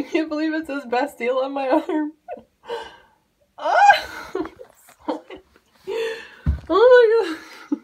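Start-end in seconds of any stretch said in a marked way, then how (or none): 3.6-4.1 short, loud high squeal; excited scream. Real, not acted. Overjoyed outburst over shirt. 6.7-7.8 loud, high burst with a laugh; flipping out. Real, not acted. Flipping out over shirt.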